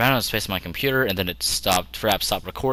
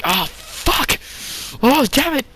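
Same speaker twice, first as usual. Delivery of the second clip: mock panicked cry; much slower than usual. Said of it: "you'll be like".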